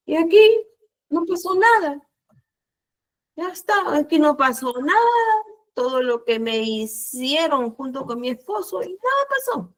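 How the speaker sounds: mucho más agudo, con indignación contenida; reclamo indignado. Retold or imitated. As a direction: protesta a Dios como una injusticia. Reclamo airado a Dios. (reclamándole a Dios, agudo e indignado)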